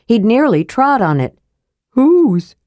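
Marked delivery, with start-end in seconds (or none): none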